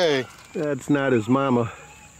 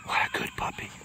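soft breathy whisper of praise; whisper to puppy; 10 dB quieter, much faster than usual. Real, not acted. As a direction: whisper praise right to the pup.

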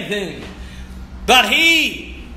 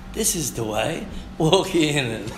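much lower, much softer, hushed; quiet voice; 6 semitones lower, 8 dB quieter than usual. Acted. The voice behind the believer, hushed and soft. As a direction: drop to a gentle inner voice. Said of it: "You will hear a voice behind you saying".

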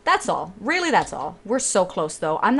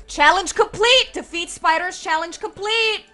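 triumphant cheer; 9 semitones higher than usual. Real, not acted. Celebrating finishing the spider challenge.

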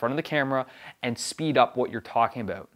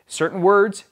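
"certain words" pitched high and punched loud; "certain words" raised sharply in pitch and volume to draw attention; raised-tone emphasis; 7 semitones higher, 7 dB louder than usual. Shown as a demonstration. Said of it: "raise your tone of voice".

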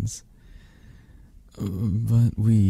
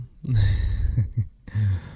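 amused chuckle. Acted. Flirting with a shy partner, amused chuckle. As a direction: let out an amused little chuckle.

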